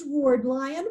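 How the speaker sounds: usual speaking voice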